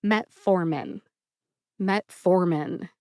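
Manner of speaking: "Metformin" with the weight on FOR; second-syllable stress. Shown as a demonstration. Pharmacist teaching a drug name, met-FOR-min stressed on the middle. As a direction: model "Metformin" as met-FOR-min, each take set apart. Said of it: "Emphasize the second syllable".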